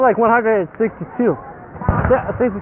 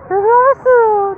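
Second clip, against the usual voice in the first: soothing to a pet; 11 semitones higher, 5 dB louder than usual. Real, not acted. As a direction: coo it like calming a puppy. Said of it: "comforting the scared dog".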